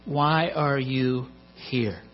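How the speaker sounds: drawn out slowly, pause before "here"; slow, weighty question. Real, not acted. Preacher pressing the congregation on life's big question, slow and weighty. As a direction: ask it slowly, letting each word land.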